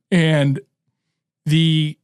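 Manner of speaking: hiccuping between words; talking through the hiccups. Real, not acted. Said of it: "I got the hiccups".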